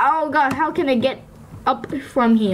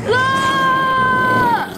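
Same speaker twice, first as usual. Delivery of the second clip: startled scream; 10 semitones higher than usual. Real, not acted. Scared by wolves in game.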